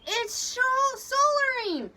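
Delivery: loud, very high-pitched cry; gasped alarm. A narrator's reading. Frightened sailor warning of shoals, alarmed cry. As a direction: cry out in panic. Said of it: "Corey gasped".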